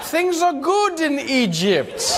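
slow, high, stilted official proclamation; hammed-up state announcer, laughing; mock official voice. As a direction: sound like a nervous regime mouthpiece. Mock state-media line.

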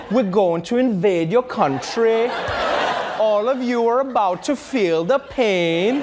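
slow sing-song Trinidadian war threat; Trinidadian accent. Acted. Host plays invading Trinidadian army, sing-song Trinidadian accent. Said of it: "it just doesn't work as an accent".

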